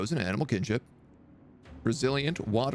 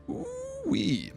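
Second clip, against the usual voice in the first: much higher, stretched delighted squeal; excited ooh-wee. Real, not acted. Reading a great unit bonus, slow high delighted squeal. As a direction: squeal with glee.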